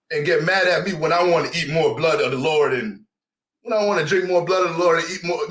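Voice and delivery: normal talking voice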